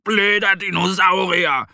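high, slow, drawn-out furious cries; angry yelling. A narrator's reading. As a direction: stretch out each angry outburst, high-pitched. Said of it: "schreit Mo und stampft vor Wut".

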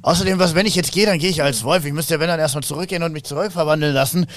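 wolf NPC protesting. Acted. GM voices werewolf NPC protesting.

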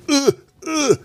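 high strained grunt as if reaching far; mock stretching strain. Pretending to reach the faraway desk, mock strained grunt. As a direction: strain like you're stretching across the room. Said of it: "ich tue jetzt gerade mal so, als ob ich jetzt hier ... überbrücken könnte, wenn ich mich recke".

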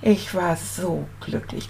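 normal talking voice

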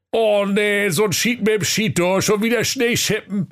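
high, whiny villager voice, far from overjoyed; grumbling coast folk. Acted. Villagers moaning about the snow, high and whiny. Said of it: "sprachen überglücklich".

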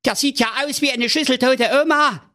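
high, horrified king voice. A narrator's reading. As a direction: shudder at the sight. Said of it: "rief der König entsetzt".

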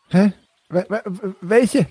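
stammering, high, frightened; scared stammer. Acted. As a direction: stammer out the question.

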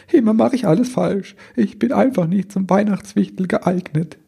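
high-pitched, self-pitying elf voice; stammering little one. A narrator's reading. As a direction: wail it in a small high voice. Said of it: "stammelte der Kleine". (hohe, verzagte Wichtelstimme)

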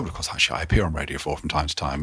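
soft, breathy, self-satisfied aside; mock preening. Telling a dinner guest he's on Radio 4, mock smug. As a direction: murmur it like quietly fishing for recognition. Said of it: "I preened myself slightly and said".

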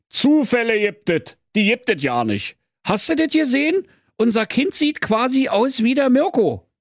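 high, scolding Berlin husband voice; grumpy Berlin husband. Acted. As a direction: nag her from the armchair. Werner voiced in story. (hoch gepresster, schimpfender Berliner Ehemann)

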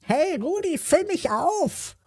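very high, slow squawking cup voice; a cup squawks its demand at the boy, very high and slow. A narrator's reading. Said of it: "quäkte die Tasse".

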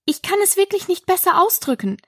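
polite high-pitched reply. A narrator's reading. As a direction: apologise courteously in a light, fast run. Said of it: "erwiderte Alice sehr höflich".

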